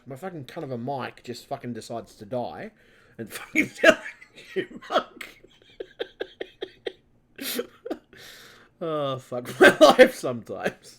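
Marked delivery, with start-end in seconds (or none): 3.2-11.0 laughing through the words, much higher and louder; slow, laughing despair; exasperated laughter. Real, not acted. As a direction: crack up in disbelief. Mic died, laughs in exasperation.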